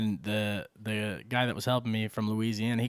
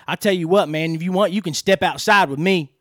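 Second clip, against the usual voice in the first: loud, higher retold threat to step outside; mock threat; 5 semitones higher, 9 dB louder than usual. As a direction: ham up the tough guy's challenge. Customer challenges him to fight.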